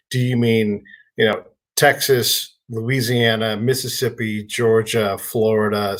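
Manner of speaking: ordinary talk, nothing marked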